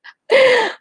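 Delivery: high, loud burst of laughter; laughing. Real, not acted. Host cracks up at her own sales plug, laughing. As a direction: crack up suddenly.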